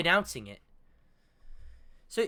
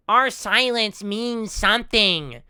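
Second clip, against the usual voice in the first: slow, drawn-out, loud put-on voice; Idubbbz; 3 semitones higher, 5 dB louder than usual. Reactor quoting a podcaster's lines, drawn-out mocking impression. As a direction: drag the words out in mimicry. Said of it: "I can put on that voice and it sounds just like him".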